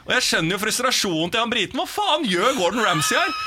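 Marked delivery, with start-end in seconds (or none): 1.8-3.4 mock frustration. Voicing the Brit's frustration.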